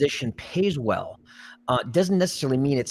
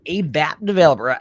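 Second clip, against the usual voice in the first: put-on Southern accent; 4 semitones higher, 7 dB louder than usual. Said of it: "I can't do the accent".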